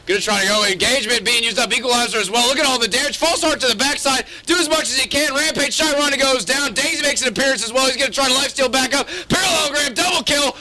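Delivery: screaming play-by-play; hype shouting. Real, not acted. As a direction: scream the penta kill call. Live play-by-play penta kill.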